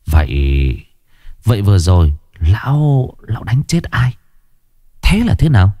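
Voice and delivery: put-on a terrified old man stammering, rising; horrified. A narrator's reading. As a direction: horror dawning on him. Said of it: "Tránh Khoan kinh hoàng". (giọng lão kinh hoàng lắp bắp)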